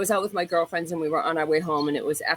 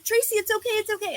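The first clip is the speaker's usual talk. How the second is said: much higher pleading friend; 14 semitones higher than usual. Retold or imitated. Girlfriend calling Tracy back from the car, high pleading. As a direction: soothe her, beg her to calm down. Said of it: "And she's like".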